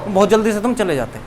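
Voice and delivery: usual speaking voice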